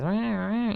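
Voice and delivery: vocal mimicry of an alarm siren; blaring siren. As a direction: wail like a cartoon alarm. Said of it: "you know, blaring siren".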